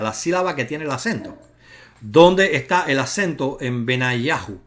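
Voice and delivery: ordinary talk, nothing marked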